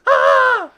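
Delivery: short high-pitched mock scream; sudden piercing imitation shriek. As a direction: imitate a horror-movie scream, loud and high. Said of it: "she screams".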